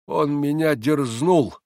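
slow, deliberate servant's grievance; drawn-out brooding complaint; gloomy, word by word. A narrator's reading. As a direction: say it sombrely with each word separated. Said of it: "мрачно и раздельно произнёс Григорий".

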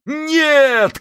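high shouted no; chorus shout. Acted. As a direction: yell it as a chorus. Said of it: "крикнули крестоносцы любви".

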